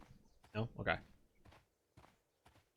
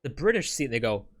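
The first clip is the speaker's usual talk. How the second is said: ordinary talk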